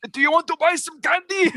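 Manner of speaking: high-pitched shopkeeper impression; candy shop guy's voice. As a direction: pitch it way up like a cartoon grandpa. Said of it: "had that exact voice".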